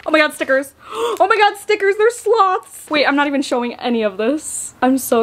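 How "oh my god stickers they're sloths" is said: excited squeal. Real, not acted. Thrilled at the stickers.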